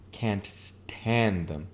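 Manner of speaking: Can't stand them drawn out very slowly; slow emphasis. Acted. Catherine confides to Nick, slow and emphatic.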